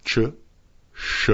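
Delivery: ordinary delivery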